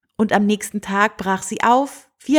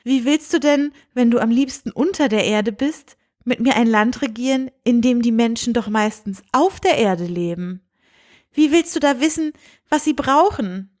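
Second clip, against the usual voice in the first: higher, gentle princess questioning the mole prince; 4 semitones higher than usual. Acted. Princess gently doubting the mole prince, higher voice. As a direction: voice the young princess, lightly raised.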